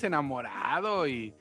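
normal talking voice

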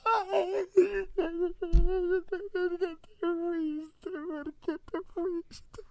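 high, slow hammed-up lament; mock wailing. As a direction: wail it like a grieving widow. Acted widow wailing over body.

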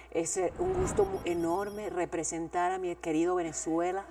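a Venezuelan beauty queen's speech, lightly done; light Venezuelan accent, soft pageant voice. Retold or imitated. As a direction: mimic a Venezuelan Miss thanking her country. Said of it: "Y además siempre decía".

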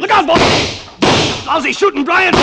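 frantic yell in a gunfight; shouted warning. Acted. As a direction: scream the warning.

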